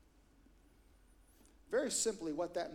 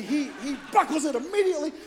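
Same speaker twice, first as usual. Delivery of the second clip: normal talking voice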